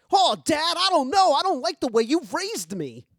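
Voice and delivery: put-on teenage son whining at his dad; whiny son. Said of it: "the son is like".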